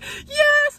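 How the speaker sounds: imitating a high, squealed cheer; very high retold squeal; excited. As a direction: mimic fans' thrilled shriek. Retold fans' thrilled answer.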